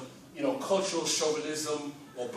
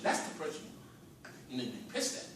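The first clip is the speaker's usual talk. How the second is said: hushed point; 7 semitones lower, 10 dB quieter, faster than usual. Real, not acted. Speaker to crowd, naming the real target, hushed. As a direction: drop to a near whisper.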